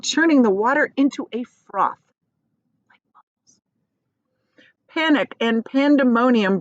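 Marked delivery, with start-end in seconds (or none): 2.9-3.6 unvoiced whisper aside. Real, not acted. Reader confessing to the children, whispered. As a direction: drop to a whisper for yourself. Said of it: "aside whispered".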